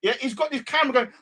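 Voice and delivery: ordinary delivery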